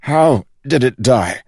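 sharp loud demand. Acted. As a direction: demand an answer, loud and high. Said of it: "demanded Holland".

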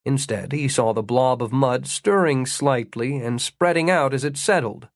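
ordinary delivery

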